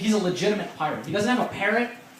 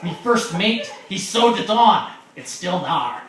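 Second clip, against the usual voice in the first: loud pirate brogue, easing at the end; 6 dB louder, slower than usual. Acted. Pirate explaining his peg leg, hearty pirate voice. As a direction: boom it out like a pirate. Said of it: "He's like".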